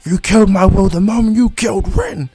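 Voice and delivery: high, loud furious accusation; bitter shouted accusation; angry accusation. Acted. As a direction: throw the blame in his face. Obito accuses Kakashi in quarrel.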